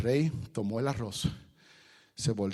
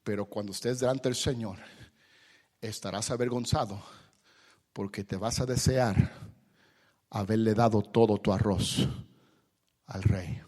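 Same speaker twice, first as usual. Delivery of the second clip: usual speaking voice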